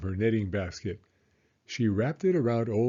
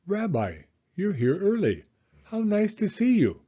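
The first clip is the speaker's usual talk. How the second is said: put-on higher, bright old grandmother voice; Baba Brena; 9 semitones higher, 4 dB louder than usual. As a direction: play the kindly old hostess, pitched up. Baba Brena greeting the visitor.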